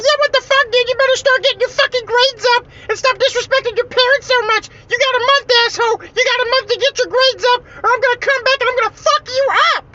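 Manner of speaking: relentless squeaky high scolding; prankster's falsetto threat. Retold or imitated. Closet creature orders boy to fix his grades, shrill falsetto threat. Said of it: "I said".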